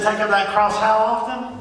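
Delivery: loud, high question to the congregation; preacher calling out for the congregation's answer; call for response. Shown as a demonstration. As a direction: pull the answer out of the crowd. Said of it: "That sounds a little better".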